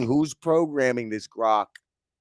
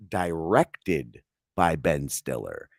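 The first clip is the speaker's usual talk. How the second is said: mock credit read; 8 semitones lower, 4 dB quieter, slower than usual. Mock-reading a show credit.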